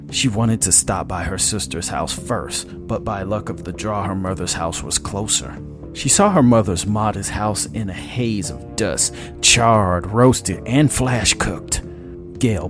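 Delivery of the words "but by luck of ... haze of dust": ordinary delivery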